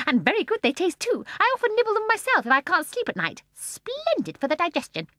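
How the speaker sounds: put-on high, loud, gleeful old woman; gleeful old lady. A narrator's reading. Mrs Corrie answering Michael, high gleeful old woman. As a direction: chirp it with glee.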